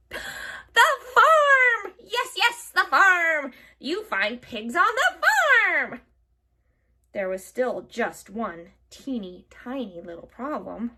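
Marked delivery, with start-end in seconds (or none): 0.1-6.0 high, loud triumphant hag; excited witch. A narrator's reading. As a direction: crow over the answer. Witch finds the answer.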